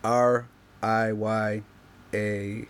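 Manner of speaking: each letter slow and separate; spelled out slowly. Shown as a demonstration. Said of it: "My name is spelled".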